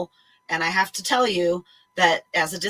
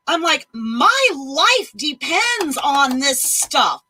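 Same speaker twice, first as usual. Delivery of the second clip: louder, higher, furious telling-off; angry rant; 8 semitones higher, 4 dB louder than usual. Retold or imitated. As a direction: let the office have it. Telling off the doctor's office.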